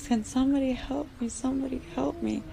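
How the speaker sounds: high, fast frightened plea; panicked cry for help. Acted. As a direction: beg for help while running, voice up high. Running from mosquitoes, calling out.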